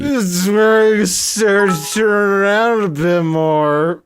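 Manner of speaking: slow, high-pitched parody of your younger self; mocking old self. Streamer parroting his old commentary video, slow high mock voice. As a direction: drag it out high, mimicking the clip.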